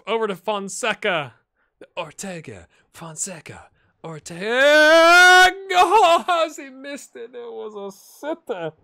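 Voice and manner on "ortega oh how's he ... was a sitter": high, loud, slow cry of dismay; real disbelief with a laugh; excited cry. Real, not acted. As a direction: groan out the missed sitter. Chance missed in the game.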